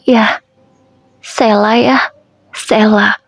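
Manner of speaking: broken up, stumbling over the name; a touch of choking on each word; mildly halting grief; halting, faltering. Acted. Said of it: "ucapku dengan terbata-bata".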